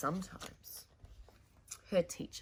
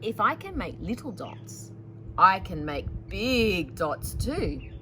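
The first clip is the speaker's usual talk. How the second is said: put-on louder, expressive read-aloud to children; story narration; 6 semitones higher, 9 dB louder, slower than usual.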